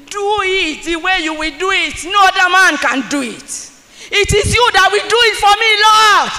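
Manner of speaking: high, loud pleading cry to God; shouted prayer. Acted. Preacher voices the believer shouting to God, loud pleading. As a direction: cry out to the Lord with everything.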